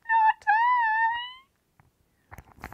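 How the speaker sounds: tiny high kitten refusal; high cat voice. Acted. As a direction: squeal it way up high. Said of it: "the cat says in her high voice".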